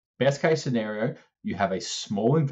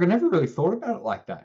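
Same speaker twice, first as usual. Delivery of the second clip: mimicking the mum, pitched much higher and a little quicker; high mock mum voice; 10 semitones higher, faster than usual. Retelling the client's mum to a friend, high mock voice. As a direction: play the surprised mum in a squeaky imitation.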